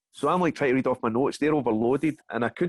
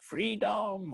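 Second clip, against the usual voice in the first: Braveheart battle cry; 8 semitones higher, 6 dB quieter than usual. Retold or imitated. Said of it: "I was going, like".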